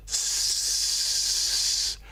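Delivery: long unvoiced hiss. Shown as a demonstration. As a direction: push the air through the teeth. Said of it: "Can you do that?".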